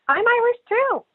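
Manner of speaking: very high-pitched imitation of an eager stranger; mock eagerness. Irish speaker mimics Americans who hear the accent, mock eager. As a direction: squeal it like someone claiming instant kinship.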